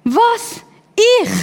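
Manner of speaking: high, sharp "Was? Ich?" in mock shock; Gideon's stunned high-pitched protest; startled disbelief. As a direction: recoil as if pointed at. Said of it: "Was ist seine Reaktion gewesen?".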